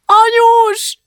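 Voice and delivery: high, loud scream for mum; child's shriek. A narrator's reading. Frightened girl calls to her falling mother, shrieking. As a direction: shriek the word in panic at full pitch. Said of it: "sikította Julcsi".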